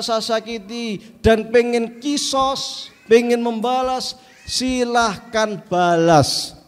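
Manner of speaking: slow, earnest plea to his companions; Prophet's farewell plea. Acted. Prophet asking companions for forgiveness, slow and earnest. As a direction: ask forgiveness gently and slowly.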